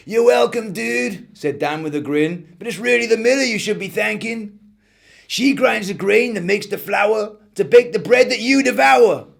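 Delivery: high, easygoing delivery guy; laid-back dude. Acted. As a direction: chill surfer-style dude voice. Laid-back van driver.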